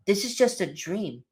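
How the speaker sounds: soft, far-away murmur, half asleep; mock dreamy murmur. Mocking Alice's repeated line, soft dreamy murmur. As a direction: mimic a drifting, dazed actress softly.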